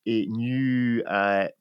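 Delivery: ordinary talk, nothing marked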